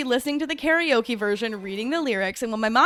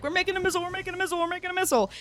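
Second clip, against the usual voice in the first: higher, repeated panicked insisting; high, pleading repeat under threat; mock-panicked prisoner; frantic Yinsen; 8 semitones higher, much faster than usual. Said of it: "And Yinsen's like".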